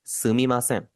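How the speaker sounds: सुमीमासेन drawn out slowly, syllable by syllable; slow model phrase. Shown as a demonstration. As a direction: spell out सुमीमासेन slowly for learners. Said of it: "Japanese में जो phrase इस्तेमाल करते हैं". (सुमीमासेन धीरे-धीरे, साफ़ उच्चारण में)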